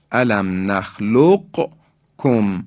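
نخلقکم with ق sounded separately; slow demo, q then k in نخلقکم; qaf pronounced. Shown as a demonstration. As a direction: keep the قاف audible in نخلقکم. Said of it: "یعنی قاف ساکن هست".

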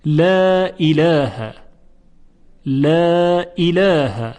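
"لا اله" slow, both l sounds light and clear; careful recitation of "لا اله" with a delicate lam; thin lam. Shown as a demonstration. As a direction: draw out "لا اله" with front, thin vowels. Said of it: "حرف لام ترقیق شده".

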